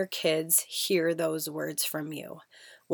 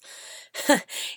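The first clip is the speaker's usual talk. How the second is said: breathy laugh; 7 semitones higher than usual. Real, not acted. Laughing about her voice.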